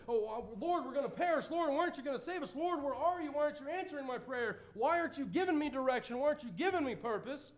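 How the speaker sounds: high, fast, whiny panic; mock fretful prayer. Worrier begging God for answers, mock panic.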